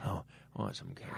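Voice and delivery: very soft, low whisper; whispered mimicry. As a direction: echo it in a barely voiced whisper. Echoing the whispered candy voice.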